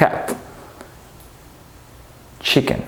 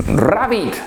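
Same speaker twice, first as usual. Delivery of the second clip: ordinary talk, nothing marked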